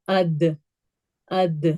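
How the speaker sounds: AD with the final D bouncing back; crisp echoing release on the D of اد; qalqala. Shown as a demonstration. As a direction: let the D in اد echo briefly. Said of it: "جب ہم دال پڑھیں گے تو".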